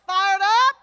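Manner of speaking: loud rising question to the crowd; rally call. Real, not acted. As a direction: demand the answer from the crowd. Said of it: "let's put it together".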